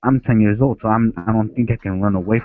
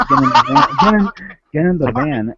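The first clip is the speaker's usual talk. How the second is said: pushy, raised retold command; imitated urging; 4 semitones higher, 4 dB louder than usual. Retelling a clown luring a kid, insistent urging. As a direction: urge them in, higher and a bit louder.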